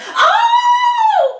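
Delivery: very high, loud startled cry; cried out. Acted. Old Tom landing in the mud, high loud startled cry. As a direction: yelp as you fall. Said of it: "cried old Tom".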